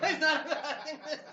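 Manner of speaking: laughing. Real, not acted. Joking about station's investor.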